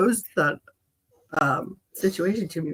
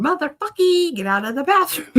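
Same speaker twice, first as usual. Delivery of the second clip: mock dad yell; 10 semitones higher than usual. Host voicing guest's dad.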